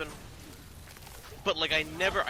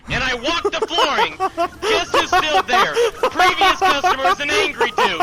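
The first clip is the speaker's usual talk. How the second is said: very high, very loud storytelling; gleeful yelling at full pitch; triumphant shouting; 14 dB louder than usual. Real, not acted. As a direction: shout the victorious punchline of a revenge story. Triumphant climax of revenge story.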